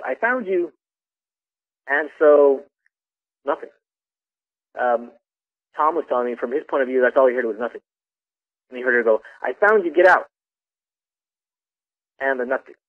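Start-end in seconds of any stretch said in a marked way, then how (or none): 0.0-0.7 retold yell. Girl announcing a hide-and-seek find, mock yell. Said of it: "she went and she yelled". 9.4-10.3 high-pitched retold call, louder at the end; imitated yell. Girl ordering a hider out, mock yell. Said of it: "she went and she yelled".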